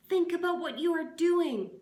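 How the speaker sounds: high, loud pleading cry. Acted. Miss Catherine cries out to the mob, high and loud. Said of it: "cried Miss Catherine".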